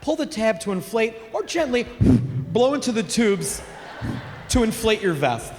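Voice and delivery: pleasant singsong safety-announcement voice; calm cabin announcer. Acted. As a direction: mimic an unbothered flight attendant. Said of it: "Who's gonna be that calm".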